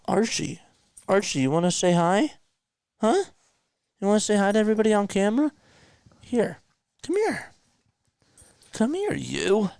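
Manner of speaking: high, fast cooing pet voice, up and down. Real, not acted. Streamer coaxing cousin's dog onto camera, high baby talk.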